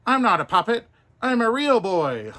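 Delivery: put-on high, loud boyish protest; insistent puppet boy. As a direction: insist like an offended little boy. Pinocchio insists.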